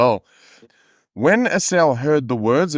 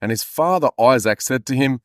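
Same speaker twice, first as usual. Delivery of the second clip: usual speaking voice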